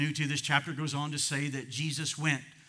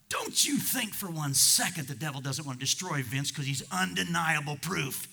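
usual speaking voice

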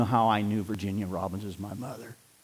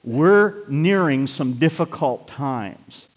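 ordinary talk

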